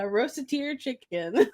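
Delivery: "rosateer" drawn out with a -teer ending; slow "rosateer" as ross-uh-TEER; mispronounced rotisserie. Retold or imitated. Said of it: "Why are you saying it like that".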